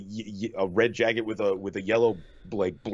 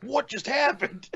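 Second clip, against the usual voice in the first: high, slow, stunned disbelief; stunned surprise; 12 semitones higher, slower than usual. Retold or imitated. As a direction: blurt it out in total shock. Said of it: "and I was like".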